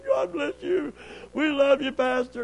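high, singsong imitation of well-wishers; affectionate couple. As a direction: coo the blessing high, as the couple. Voices the couple blessing him.

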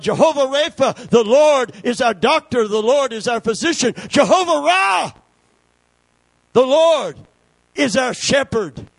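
high, loud proclamation of God's names; full-force preaching. Acted. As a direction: ring each name out to the back pews.

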